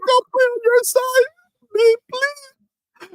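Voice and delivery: very high mock sobbing beg; hammed-up tearful whine; crying plea. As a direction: plead in a squealing crybaby voice. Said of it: "crying, crying".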